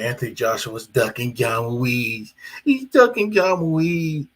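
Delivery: imitating the critics slowly; mock critic. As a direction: sneer it like a know-it-all fan. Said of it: "I know what people like to say".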